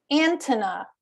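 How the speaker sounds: ANTENNA stressed on the first syllable, AN-tenna; misplaced stress. Retold or imitated. Colleague from Kenya naming his purchase to a shopper, misplaced stress. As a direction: punch AN and let "tenna" fall away in antenna. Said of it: "he had the stress on the wrong syllable".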